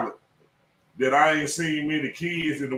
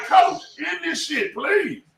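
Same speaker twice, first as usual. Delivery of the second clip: high, urgent, drawn-out plea; pleading with coach; 11 semitones higher, slower than usual. Retold or imitated. Coach retelling his plea to the head coach, high and desperate. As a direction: beg the coach to give Eaton the ball.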